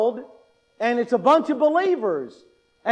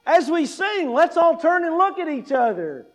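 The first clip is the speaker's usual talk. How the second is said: a higher, cheery mock worship-leader call; 6 semitones higher than usual. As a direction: lightly parody the pushy song leader. Mocking a worship leader's request.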